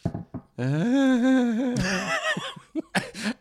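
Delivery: long, high eerie laugh; drawn-out creepy laugh imitating the driver; haunting laugh. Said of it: "he does this haunting laugh".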